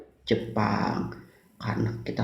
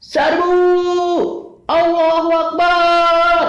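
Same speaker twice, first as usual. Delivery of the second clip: battle cry at full shout; charge, yelling with all you have; loud gung-ho soldier; 9 dB louder than usual. Acted.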